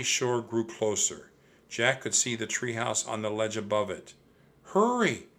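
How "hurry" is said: high urgent call. A narrator's reading. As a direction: call out to the serpent to hurry. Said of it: "Annie called to the serpent monster".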